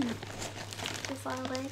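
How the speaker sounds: talking through a mouthful; eating while talking; mouth full. Real, not acted. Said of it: "Sorry, I'm just eating".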